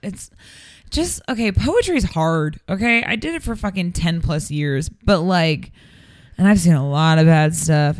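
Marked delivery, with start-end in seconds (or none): none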